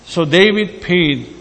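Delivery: usual speaking voice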